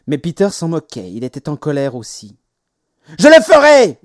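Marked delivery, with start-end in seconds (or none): 3.2-4.0 put-on high, furious child's yell. A narrator's reading. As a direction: yell it in full defiance. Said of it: "hurla-t-il".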